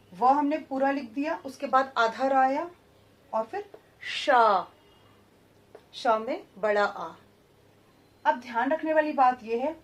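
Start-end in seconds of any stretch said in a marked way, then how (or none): 3.3-4.7 शा stretched slowly; drawn-out शा. Shown as a demonstration. Teacher showing the long आ in वर्षा, slow. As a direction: draw out the long आ. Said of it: "syllable demo".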